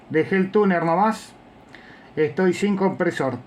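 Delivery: normal talking voice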